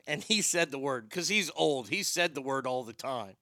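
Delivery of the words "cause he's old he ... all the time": ordinary delivery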